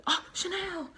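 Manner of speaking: high startled yelp of her name; mock startled cry; startled girl. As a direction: squeal it like you've been caught naked. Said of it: "the girl was like".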